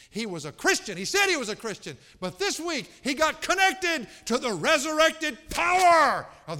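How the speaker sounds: full-force pulpit shout; impassioned retold testimony. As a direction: preach the wife's words to a roaring climax. Wife's testimony builds to climax.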